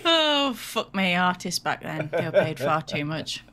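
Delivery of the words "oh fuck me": drawn-out groan of disbelief; slow exasperated oath. Real, not acted. Reacting to artist's pay.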